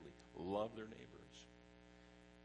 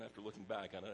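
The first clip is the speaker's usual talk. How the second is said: ordinary delivery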